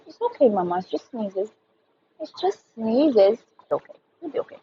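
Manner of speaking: high, tender cooing to calm someone sneezing. Real, not acted. Comforting someone who keeps sneezing.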